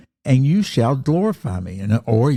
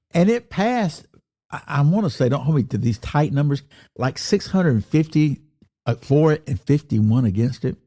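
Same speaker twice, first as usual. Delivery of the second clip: ordinary talk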